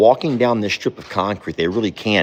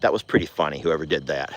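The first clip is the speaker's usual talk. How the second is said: ordinary talk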